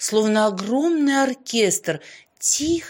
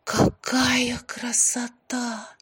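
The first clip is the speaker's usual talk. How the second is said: slow, spellbound whisper; hushed, drawn-out amazement; awed whisper; 3 semitones higher, much slower than usual. A narrator's reading. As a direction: whisper it slowly in wonder. Wife awestruck by the snowfall.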